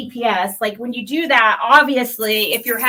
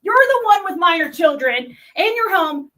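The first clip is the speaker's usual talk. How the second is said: ordinary talk, nothing marked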